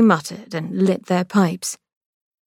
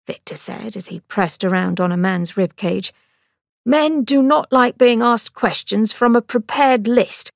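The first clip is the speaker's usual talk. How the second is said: Victor, sardonic; 5 semitones higher than usual. A narrator's reading. Brother giving sister cutting advice, sardonic. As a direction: fire back with dry advice.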